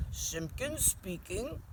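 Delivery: slow, measured, pompous phone greeting; stately and deliberate on the telephone; self-important telephone voice. A narrator's reading. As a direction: answer the phone very importantly, drawing it out. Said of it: "in his very important telephone voice".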